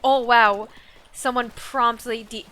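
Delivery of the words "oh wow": ordinary talk, nothing marked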